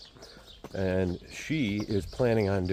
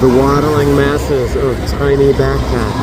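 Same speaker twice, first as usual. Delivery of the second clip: loud, slow, mock-epic description; mock grand announcement; 5 semitones higher, 12 dB louder than usual. Real, not acted. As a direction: declaim it like a nature documentary. Mocking the tourist crowd.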